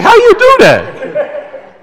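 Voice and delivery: high, drawn-out incredulous question; comic squeal of baffled amazement; mock amazement. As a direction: play up the disbelief, slow and very high. Amazed at wife's easy carrying.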